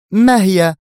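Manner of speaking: high, loud, eager burst; eager question. A narrator's reading. Mona pressing Adham for his plan, eager. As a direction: jump in, dying to know. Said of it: "سألته منى في حماس".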